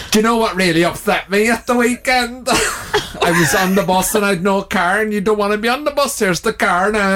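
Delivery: Declan. Acted. Player having another go at his friend selling cars, hammed-up impression. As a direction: exaggerate the chatty salesman, drawing out the ending. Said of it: "I'll do it again".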